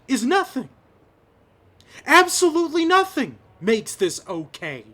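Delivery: high, emphatic, impassioned demand; indignant plea pitched well above normal; real outrage. Real, not acted. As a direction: raise your voice in indignation, punching key words. Outraged at the killings, demanding answers.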